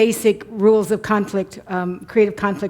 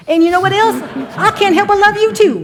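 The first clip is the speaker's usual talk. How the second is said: high, loud Southern gush; Southern dialect; 10 semitones higher, 6 dB louder than usual. Pretend. Wife answering husband's sweet talk, big Southern accent. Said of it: "when you're in a Southern dialect".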